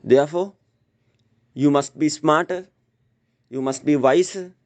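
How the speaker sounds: ordinary talk, nothing marked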